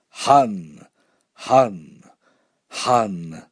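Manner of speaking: trying out a word, each time on its own; practicing a new word. A narrator's reading. Child practicing a new word, trying it out. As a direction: say it separately, with falling pitch. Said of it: "I practiced the new word".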